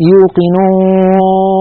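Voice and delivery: يوقنون slow with the waw leaking into the nose; nasal waw. Shown as a demonstration. Teacher showing nasal waw that fails the nose test. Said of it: "فنجد بأن الصوت يختل".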